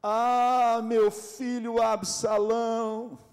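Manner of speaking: loud, high, slow wail of a grieving father; anguished cry. Acted. King David crying out for his dead son, loud wail. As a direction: cry out for a lost son, drawn out.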